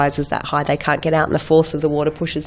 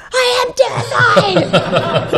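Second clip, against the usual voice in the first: much higher, slow laughing cry; laughing shout; much slower than usual. Retold or imitated. Actress answering the crew, high slow laughing shout. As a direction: shout it while cracking up. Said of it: "I'm like".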